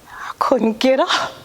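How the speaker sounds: real whisper as memory overwhelms her; real broken whisper. Real, not acted. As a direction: voice drops to a breathless whisper. Breaking down while testifying.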